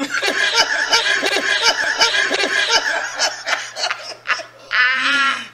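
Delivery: high shrieking laugh; loud wheezing laughter fit. Real, not acted. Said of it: "Is it really that funny".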